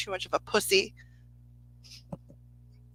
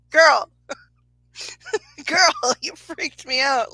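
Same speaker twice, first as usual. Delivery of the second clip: louder, higher, slow mock scold broken by a laugh; 6 semitones higher, 5 dB louder, slower than usual. Scolding the playful spirit, slow and laughing. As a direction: drag out girl as if scolding a friend.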